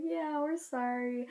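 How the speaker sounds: softly drawled, half-hearted sorry; awkward apology. Retold or imitated. Girl's dad excusing daughter and leaving, awkward and soft. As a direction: mimic an apologetic mumbler edging away. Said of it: "the most awkward apology".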